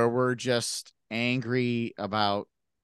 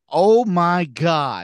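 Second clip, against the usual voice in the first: mock awe; 5 semitones higher, 7 dB louder, much slower than usual. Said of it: "I just was like".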